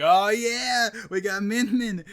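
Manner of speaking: loud, high hype cheer; mock celebration. Fan reacting to the Min Min reveal, excited. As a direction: whoop it up like a thrilled fan.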